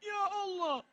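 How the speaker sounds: high, soft mock wail; imitated crying. Preacher mimicking crowd crying along, soft mock wail. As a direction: whimper it high as if weeping along. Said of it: "ikut nangis".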